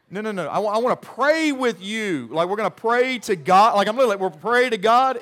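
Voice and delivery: loud, high, insistent correction; calling out loud. Retold or imitated. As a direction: raise the voice so a hard-of-hearing old lady hears. Insisting to deaf old woman.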